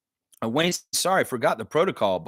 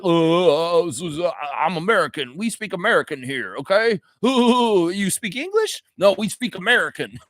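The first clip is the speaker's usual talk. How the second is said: louder, hammed-up dim American caricature; goofy mocking American voice with silly laughs; 6 semitones higher, 3 dB louder than usual. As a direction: put on a cartoonish, insistent American know-nothing. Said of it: "Americans that are like".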